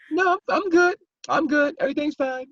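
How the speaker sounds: high falsetto mock of someone insisting they're fine; fake fine. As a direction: squeak it out like a bad liar pretending all is well. Mocking someone pretending all is fine.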